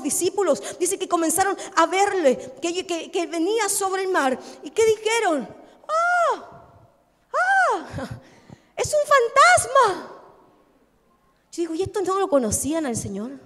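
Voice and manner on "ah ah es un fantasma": high-pitched mock scream of terror, drawn out; hammed-up fright, voice leaping high; frightened cry. Disciples seeing Jesus walk on the sea, frightened cry. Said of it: "comenzaron a gritar".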